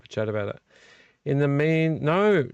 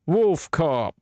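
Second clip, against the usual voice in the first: ordinary talk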